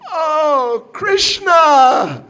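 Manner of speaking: loud, high exasperated shout; frustrated cry. Acted. Lady at wits' end with crying child, frustrated shout. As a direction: cry out at the end of your tether. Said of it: "she just got so frustrated".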